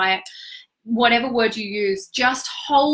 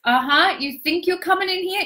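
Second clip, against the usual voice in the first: higher, lightly acted challenge; challenging shadow archetypes; 8 semitones higher than usual. Shadow archetypes confronting the coach at their territory, mildly challenging. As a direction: lightly voice a defiant gatekeeper. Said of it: "they're all like this".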